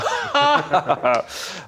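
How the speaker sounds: loud, high burst of laughter; laughing. Real, not acted. Writer joking about The Sun, loud high laugh. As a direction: crack up at your own joke.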